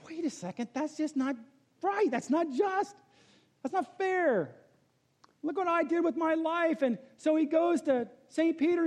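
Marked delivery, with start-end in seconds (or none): 0.1-6.8 higher, lightly voiced protesting pastor; mock outrage at the unfairness; indignant pastor. Pastor protesting his shanty, mild indignation. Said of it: "the pastor's thinking".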